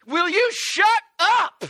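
high, loud, drawn-out yell; irritated yell. Acted. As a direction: yell out the bedroom window at a barking dog. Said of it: "like he's yelling out of his bedroom window".

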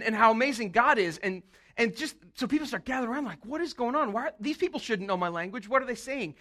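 usual speaking voice

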